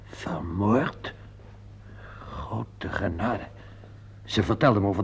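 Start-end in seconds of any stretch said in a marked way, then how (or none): 0.0-3.4 hushed, slow and much softer, as if the breath has gone; stunned disbelief. Acted. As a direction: barely get the words out, in shock. Learns Delia was murdered. (geschokt en zacht gefluisterd)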